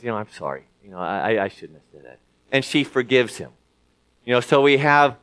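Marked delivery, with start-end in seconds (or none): 0.0-2.2 much softer and lower, a shamefaced sorry; soft sheepish apology. Retold or imitated. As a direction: hush it like an embarrassed friend owning up. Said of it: "Guy says".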